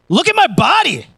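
high, loud mock bragging; showing off. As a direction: strut it like you're flexing a new body. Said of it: "I'd be like".

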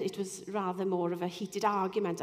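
normal talking voice

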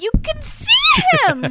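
high shouted can't-believe-it; high exasperated shout, a real one. Real, not acted. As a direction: yell it at the screen. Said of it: "I'm like".

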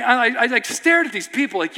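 ordinary talk, nothing marked

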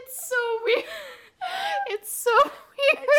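high voice weak from laughing; helpless laughter. Real, not acted. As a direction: squeeze words out while cracking up. Both hosts cracking up.

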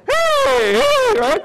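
high, loud excited hey to friends; calling out. Retold or imitated. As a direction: call them over across the street.

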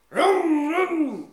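very high, loud imitated engine revving; engine vroom. Storyteller imitating the Ford Focus revving, very loud. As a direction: rev it like a car engine. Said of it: "is like".

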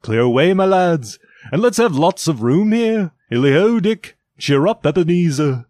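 loud, hearty hailing; calling out orders. A narrator's reading. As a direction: call jovially across the room to the lads. Fezziwig calling orders to apprentices.

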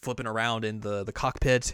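ordinary talk

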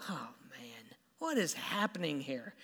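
low, whispered dismay; quietly fearful Saul. Retold or imitated. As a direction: mutter it under the breath, as if worried. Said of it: "I think Saul's afraid".

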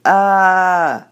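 high, loud sustained demo scream; hammed-up scream; scream sound. Shown as a demonstration. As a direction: demonstrate a scream.

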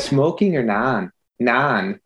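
a light Minnesota lilt on the vowels; slight Minnesota accent, long drawn non. Retold or imitated. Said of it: "say it in a Minnesota accent".

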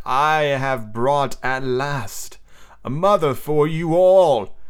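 very slow, grand proclamation; proud cry. A narrator's reading. Peter telling the boys glorious tidings, crying out grandly. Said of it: "he cried".

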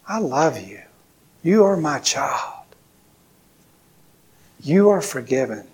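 soft, gentle voice with long pauses; tender reassurance. Acted. Pastor voices God comforting his child, soft and tender. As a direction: say it quietly, letting each assurance settle. Voicing God's tender words to listeners.

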